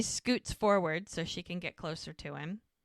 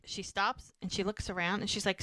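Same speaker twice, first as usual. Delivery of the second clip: ordinary talk, nothing marked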